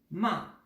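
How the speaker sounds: ma falling sharply like a final full stop; fourth tone ma. Shown as a demonstration. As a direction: drop ma hard and short. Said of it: "comme si vous finissiez une phrase avec un point final". (ma au quatrième ton, tombant sec)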